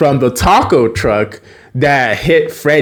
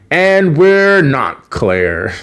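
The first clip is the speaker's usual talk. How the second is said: slow, stretched, rising delivery; retold drawn-out sign-off; 3 semitones higher, much slower than usual. Freddy signs off the spattered show, drawn out. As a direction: stretch out each word for effect.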